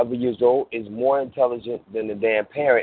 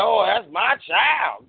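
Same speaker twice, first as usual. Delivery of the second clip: proud parent; 9 semitones higher, slower than usual. Retold or imitated.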